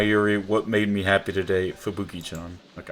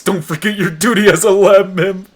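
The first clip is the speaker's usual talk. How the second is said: a high, loud put-on voice for the in-game message; mock character voice; 9 semitones higher, 10 dB louder than usual. Reading a game character's mail.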